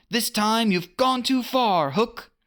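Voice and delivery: put-on high boyish defiance; a brave boy's challenge; Peter defiant. A narrator's reading. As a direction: warn the pirate boldly. Peter voiced in story.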